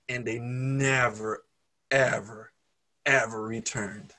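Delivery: never, ever, ever drawn out slowly; stress on never ever. Real, not acted. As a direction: lean slowly on each ever. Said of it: "slow emphatic ending".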